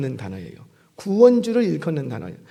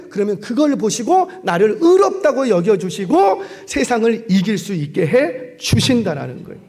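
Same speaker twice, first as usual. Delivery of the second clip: usual speaking voice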